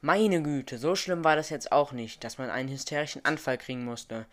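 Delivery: ordinary delivery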